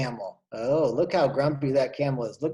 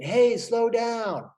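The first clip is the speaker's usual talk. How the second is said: much higher, slow, pleading call; calling after them; 8 semitones higher, 4 dB louder, much slower than usual. Acted. Blackbird chasing swallows, high slow call.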